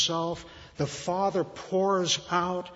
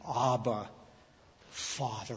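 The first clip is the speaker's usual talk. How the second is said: tender hush; 4 semitones lower, 6 dB quieter than usual. Retold or imitated. Tender address to God.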